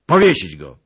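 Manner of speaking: very loud, sharp command; shouted order. A narrator's reading. Lord orders his men to hang the beggar, shouting. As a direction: shout it as a death sentence. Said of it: "krzyknął pan Skrzytuski".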